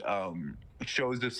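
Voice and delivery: ordinary talk, nothing marked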